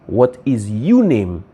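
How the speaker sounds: slowly spotlight the wrong word YOU; stress on YOU. Shown as a demonstration. Reading out the wrong wording.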